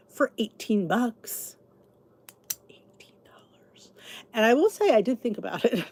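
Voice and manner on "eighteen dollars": incredulous whisper. Real, not acted. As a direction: whisper the price in disbelief. Shocked at the syrup price.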